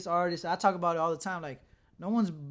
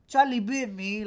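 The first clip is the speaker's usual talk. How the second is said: imitated little kid's English accent, louder and higher; mock English accent, pitched up; 4 semitones higher, 7 dB louder, slower than usual. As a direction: mimic a child with an English accent. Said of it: "they have this accent, like, they're from England".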